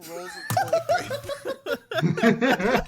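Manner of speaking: words pushed out between laughs; laughing. Real, not acted. Cracking up at the punchline.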